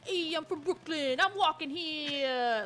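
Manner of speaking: hammed-up Brooklyn accent, drawn out at the end; exaggerated, amateur Brooklyn accent. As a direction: attempt a stock Brooklyn accent. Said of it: "I can do a Brooklyn accent".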